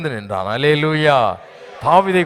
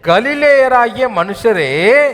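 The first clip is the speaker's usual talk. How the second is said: drawn out, higher and louder; slow emphatic echo; 5 semitones higher, 4 dB louder, much slower than usual. Real, not acted. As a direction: stretch each word like a proclamation. Preacher echoes very slowly. (மெதுவான உரத்த எதிரொலி)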